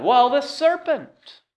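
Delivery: high, slow drawn-out blame; blaming Eve. Retold or imitated. Eve blaming the serpent before God, high and slow. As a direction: stretch it out, pointing at the serpent. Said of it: "God goes to the woman".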